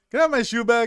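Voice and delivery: squeaky high voice, asking for his shoe; high mock old man. Retelling the Stan Lee cameo, high mock voice. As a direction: pitch way up for the cameo guy.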